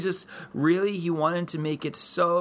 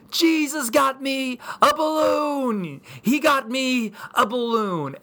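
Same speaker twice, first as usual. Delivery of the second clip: put-on high, drawn-out child's joyful yell; excited yelling; 10 semitones higher, 4 dB louder, slower than usual. Daughter bursts out over her balloon, excited yelling. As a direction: imitate a little girl yelling in delight. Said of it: "she just bursts out yelling".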